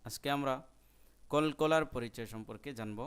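ordinary talk, nothing marked

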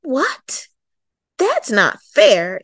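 loud, slow outraged protest; mild mock shout of a kid who finds it unfair; child's indignant shout. A narrator's reading. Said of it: "Emily shouted".

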